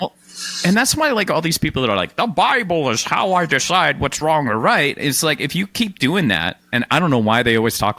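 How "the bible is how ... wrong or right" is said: crowing churchy voice; religious person voice. Retold or imitated. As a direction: play a preachy believer. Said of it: "Sound like an old crow".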